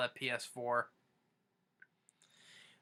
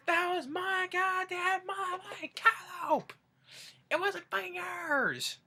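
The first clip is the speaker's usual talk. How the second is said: angry grandpa yell; slower than usual. Retold or imitated. Imitating Grandpa raging.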